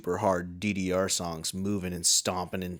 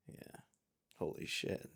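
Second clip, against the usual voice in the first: normal talking voice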